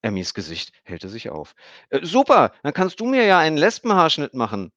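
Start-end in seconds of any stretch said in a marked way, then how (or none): 1.9-4.7 put-on high, loud excited girl; light up with delight; beaming. A narrator's reading. Emmy asks Stefan for a haircut, beaming high girl's voice. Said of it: "strahlte sie".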